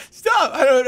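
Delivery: high squeal through laughter; begging through helpless laughter; laughing shriek. Real, not acted. Overcome with laughter.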